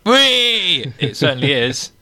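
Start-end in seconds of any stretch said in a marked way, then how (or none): 0.1-0.9 high, drawn-out whoop; celebratory cheer. Real, not acted. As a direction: let out a gleeful wheee. Celebrating a finished chapter.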